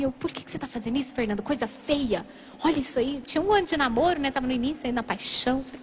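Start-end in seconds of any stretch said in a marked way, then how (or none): 0.1-3.2 low-key muted self-scolding; mild self-reproach. Retold or imitated. Ashamed girlfriend talks to herself, muted reproach. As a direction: imitate chiding oneself with head down. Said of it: "eu abaixei a cabeça assim".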